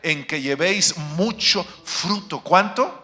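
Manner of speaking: loud, high, slow preaching of the verse; preached quote. Acted. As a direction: proclaim the words of Jesus at full force, slowly. Sermón, cita de Jesús a gritos. (cita proclamada a gritos, lenta y aguda)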